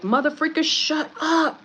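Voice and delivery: hammed-up irritated command, pitch climbing; mock outburst. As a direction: play a blunt censor shutting someone down. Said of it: "Being banned is like".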